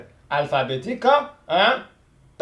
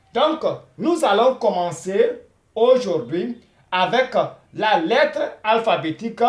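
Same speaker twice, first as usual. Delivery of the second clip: usual speaking voice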